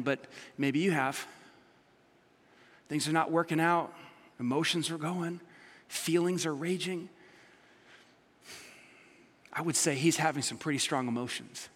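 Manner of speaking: ordinary talk, nothing marked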